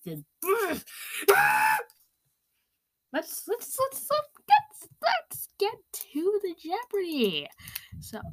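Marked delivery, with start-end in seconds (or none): 0.3-1.8 loud, high put-on sob; mock crying outburst. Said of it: "really sad and very intense". 3.1-7.5 high-pitched, stammering fake crying; mock tearful outburst. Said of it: "really sad and very intense".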